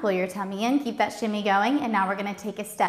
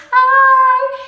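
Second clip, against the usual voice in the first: high, loud sung-out hi; excited hi; 11 dB louder than usual. Real, not acted. Teacher greeting a viewer in the chat, high and sung out. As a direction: sing out a bright greeting.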